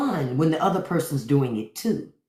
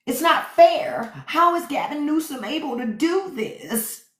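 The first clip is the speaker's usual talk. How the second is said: mocking MAGA crybaby voice; mock whiny troll; 7 semitones higher than usual. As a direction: whimper it like a sore loser. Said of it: "They go".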